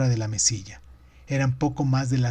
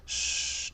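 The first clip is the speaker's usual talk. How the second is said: soft long shh; soothing shush; 9 dB quieter than usual. A narrator's reading. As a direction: hush a crying child. Calma al niño. (un shh suave para calmar)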